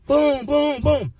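high punchy boom boom imitation; door kicks. Inmate imitating kicking his cell door. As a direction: mimic heavy kicks on metal.

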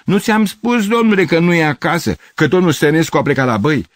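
high, loud telling-off; exasperated scolding. Acted. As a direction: scold someone who will not listen. Neighbour scolds the stranger.

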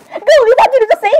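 put-on high-pitched outburst to a girlfriend; shocked disbelief. Character reacting to a friend's news, shocked disbelief. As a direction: pitch shoots up in giddy shock.

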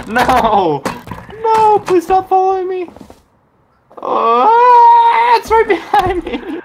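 panicked high yelps while fleeing; shrill pleading as the bear follows; being chased. Real, not acted. Bear chasing him.

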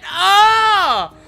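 sudden high yelp of pain; annoyed shout at the missed hit; frustrated yell. Real, not acted. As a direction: let out a sharp, pained cry. Hit by a tree as killer.

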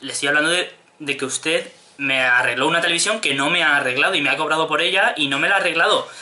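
normal talking voice

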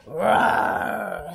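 loud storybook monster roar; mock dragon growl-roar; pretend dragon roar. A narrator's reading. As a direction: roar like a dragon, drawn out and falling. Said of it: "said Spud".

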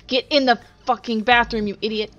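loud frustrated shout; yelling. Real, not acted. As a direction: bark it at yourself. Yelling at self while fleeing.

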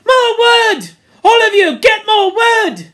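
loud, high commanding shout. Acted. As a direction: yell the order at all of them. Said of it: "and Ralph shouted".

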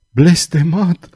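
usual speaking voice

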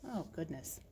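ordinary delivery, nothing marked

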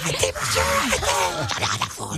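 shouting in a whisper. Retold or imitated. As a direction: yell silently, all breath. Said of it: "il crie en silence".

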